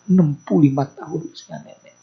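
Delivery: ordinary talk, nothing marked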